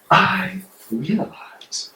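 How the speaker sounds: slow, hushed revelation; whisper. Acted. Bonhoeffer confiding to the audience in a slow whisper. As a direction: breathe it like a secret dawning.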